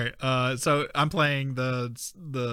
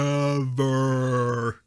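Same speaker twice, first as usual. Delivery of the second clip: echoing the quote; 3 dB louder, much slower than usual. Retold or imitated.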